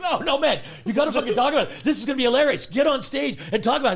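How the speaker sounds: loud, high and laughing; egging him on; excited urging. Retold or imitated. Friend urging him on stage, loud and excited.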